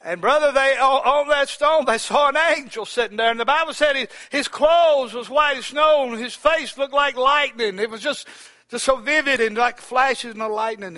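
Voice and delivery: high, loud, ringing preacher's cry; preaching at full force. Acted. Preacher painting the angel for his brothers, loud and high. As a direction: lift the angel vision up to the rafters.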